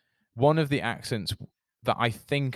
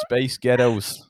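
put-on Scottish-sounding phrase; Glaswegian-sounding accent; 4 dB louder, slower than usual. Shown as a demonstration. Showing the co-host the trick once more, Scottish accent. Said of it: "you sound like a Glaswegian".

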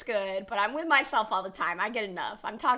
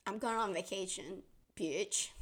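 much softer, snide mock quote; imitating a snide brush-off, softer; snarky; 3 semitones lower, 10 dB quieter than usual. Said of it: "that one sounded more like".

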